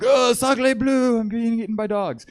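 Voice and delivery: high, loud mock-French wail; French guy in terror. French guy haunting grandpa's nightmares, high hammed-up wail. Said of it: "nightmares of the French guy just like".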